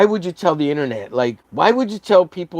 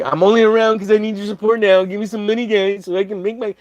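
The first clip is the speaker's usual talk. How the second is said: mock begging artist; 7 semitones higher, 3 dB louder than usual. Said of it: "you're like".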